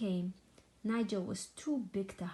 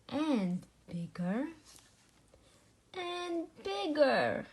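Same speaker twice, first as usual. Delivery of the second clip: slow, each bigger higher and louder; building emphasis; 7 semitones higher, 3 dB louder, slower than usual. A narrator's reading. Storyteller building up how big the sheep got. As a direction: stretch it out, growing bigger.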